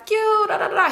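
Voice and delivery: high-pitched mock yell without real volume; mock screaming. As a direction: imitate her screaming in nonsense syllables. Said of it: "she just screaming at him like".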